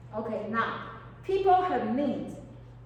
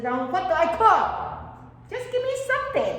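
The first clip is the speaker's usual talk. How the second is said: higher, louder, fast pleading; exasperated mother; 6 semitones higher, 6 dB louder than usual. Retold or imitated. Mother begging her child to pick dinner, higher and loud. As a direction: plead like a fed-up mom at the stove. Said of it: "And your mother said".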